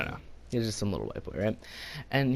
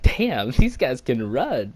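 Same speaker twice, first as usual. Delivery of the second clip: louder, slow, drawn-out amazement; mock shock; 5 dB louder, much slower than usual. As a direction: react as if stunned by their speed. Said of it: "I was in shock".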